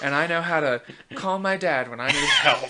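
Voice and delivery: laughing through the words, loud. Real, not acted. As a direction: giggle while joking about yourself.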